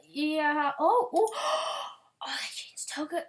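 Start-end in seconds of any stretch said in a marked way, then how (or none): 1.3-3.2 high gasp into whispered shock; stunned hushed reaction. Real, not acted. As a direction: gasp then whisper in surprise. Surprised by new design.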